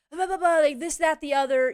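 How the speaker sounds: blaring blah-blah imitation of a tirade; mock screaming. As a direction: imitate her scolding you like a child. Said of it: "screaming at me like I'm one of her children".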